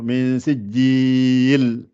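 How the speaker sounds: sijjil with the L drawn out; slow careful sijjil, L held; clear L. Shown as a demonstration. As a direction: let the L ring out in sijjil. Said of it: "Laisse bien le L sortir".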